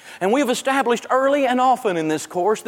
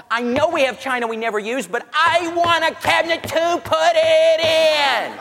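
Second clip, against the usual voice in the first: whiny woman; 8 semitones higher than usual. Retold or imitated. Woman pleading for a china cabinet, whiny. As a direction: wheedle like a child wanting a treat. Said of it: "another woman standing there going".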